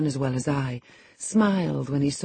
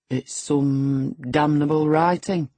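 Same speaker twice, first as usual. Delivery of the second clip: Hareton, lightly voiced slow boor; 4 dB louder, slower than usual. A narrator's reading. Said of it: "he answered".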